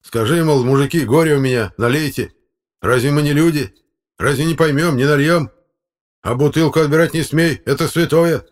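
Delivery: high, loud protest; heated appeal. Acted. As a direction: plead your case indignantly. Electrician's heated appeal to chairman. (с жаром, возмущённо)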